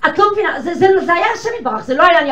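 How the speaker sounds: high, loud insistent protest; a wife's heated protest; excited protest. Retold or imitated. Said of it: "היא אומרת לי".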